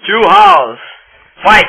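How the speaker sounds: loud, high fight call; fighting game announcer. Pretend. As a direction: shout the round like an arcade announcer. Announcing the next round.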